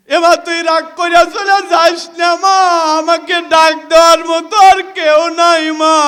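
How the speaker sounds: desperate plea. Acted. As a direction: rush the plea out, almost shouting. Child begs mother not leave.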